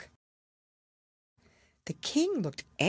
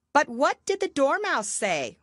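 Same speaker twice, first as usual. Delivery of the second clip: curious juror; 10 semitones higher, 4 dB louder than usual. Acted. Juror voiced.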